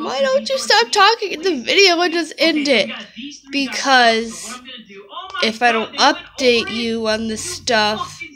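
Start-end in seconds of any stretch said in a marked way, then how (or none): 0.0-2.9 higher-pitched mock commenter; mimicking a nagging comment; imagined viewer comment. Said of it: "before you comment down below".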